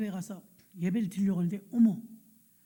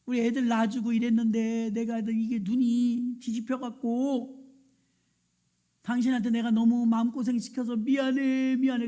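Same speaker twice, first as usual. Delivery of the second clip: melodramatic sobbing confession; 3 semitones higher, 4 dB louder than usual. Retold or imitated. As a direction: pour out a hammed-up husband's weepy remorse. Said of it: "심파극을 찍어요". (울먹이며 길게 늘어놓는 신파조 사과 흉내)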